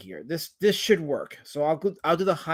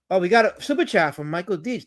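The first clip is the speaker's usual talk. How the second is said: ordinary delivery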